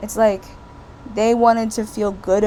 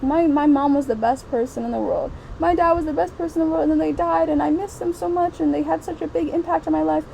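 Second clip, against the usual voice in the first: higher, fast gushing parody of a tribute; mocking sentimental cliché; 6 semitones higher than usual. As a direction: send up a syrupy memoir. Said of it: "books and movies that are like".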